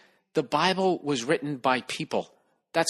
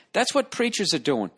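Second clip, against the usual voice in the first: ordinary talk, nothing marked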